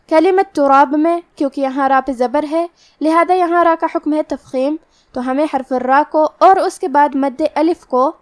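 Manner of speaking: ordinary talk, nothing marked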